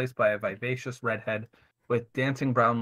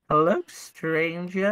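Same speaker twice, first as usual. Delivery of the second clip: slow, high breathy seductress purr; femme fatale; 7 semitones higher, 5 dB louder, much slower than usual. A narrator's reading. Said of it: "she whispers".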